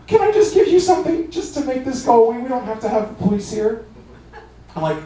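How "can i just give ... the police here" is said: high, pitiful mock pleading; pleading offer. Driver begs three women to avoid police, high mock plea. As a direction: beg them to let it go.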